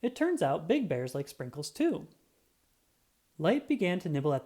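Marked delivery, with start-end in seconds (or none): none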